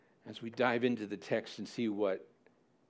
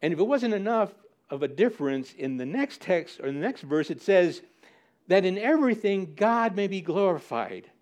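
ordinary talk

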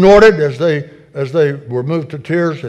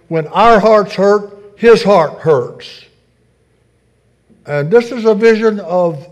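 fervent preaching; 5 semitones higher, 4 dB louder than usual. Acted. Preacher to his church, impassioned full-voiced preaching.

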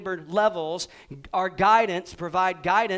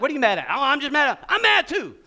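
mock mad friend; 7 semitones higher, 7 dB louder than usual. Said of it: "You're like".

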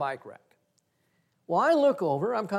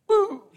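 punch sound; 8 dB louder than usual. Retold or imitated.

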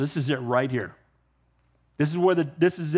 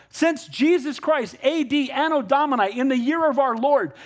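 triumphant retort; 8 semitones higher than usual. Retold or imitated.

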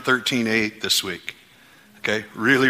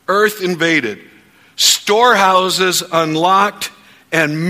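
preaching declarations; 4 semitones higher, 4 dB louder than usual. Acted. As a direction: proclaim it over the church at full force. Leads congregation in faith declarations.

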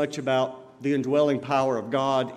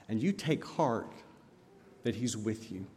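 tender close; 5 semitones lower, 9 dB quieter than usual. Acted. Closing tender reassurance.

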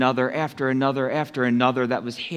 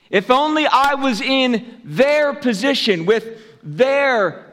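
high, slow, emphatic wishing; envious longing; 9 semitones higher, 4 dB louder, slower than usual. Acted. Preacher voicing a believer who envies the villain, envious longing. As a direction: act out envy, drawing out the words at a raised pitch. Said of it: "look at that villain with envy".

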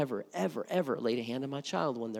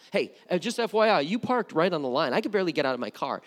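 mock petty complaint; 4 semitones higher, 6 dB louder, faster than usual. Petty complaint about parking.

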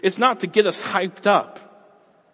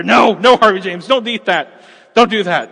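higher, louder frantic parent calls; scolding parent; 5 semitones higher, 10 dB louder than usual. Retold or imitated. Parent scolding toddler Harvey James, loud frantic calls. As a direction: chase down a toddler with firm no's.

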